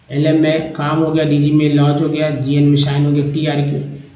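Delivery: normal talking voice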